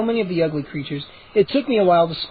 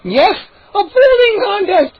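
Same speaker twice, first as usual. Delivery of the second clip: much higher, loud, gleeful shouts; excited gnome shouts; 13 semitones higher, 6 dB louder than usual. Acted. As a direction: yell out cruel games with glee. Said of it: "another gnome cried".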